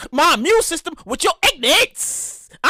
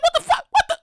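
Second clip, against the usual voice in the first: shocked shriek; 12 semitones higher than usual. Retold or imitated. Confronting his coworker without a mask, panicked high shriek. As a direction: freak out at the maskless coworker. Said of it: "I was like".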